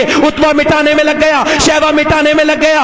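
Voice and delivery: steady, projected sermon narration; insistent pulpit delivery; forceful preaching. Real, not acted. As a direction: tell it loudly to a whole congregation.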